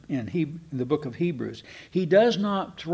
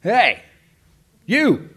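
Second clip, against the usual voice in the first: loud high shout of Hey you; yell; 7 semitones higher, 7 dB louder than usual. Shown as a demonstration. Preacher showing how all-caps email sounds, yelling. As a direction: call out sharply like all caps. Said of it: "It's like yelling".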